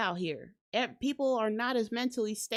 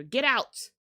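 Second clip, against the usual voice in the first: normal talking voice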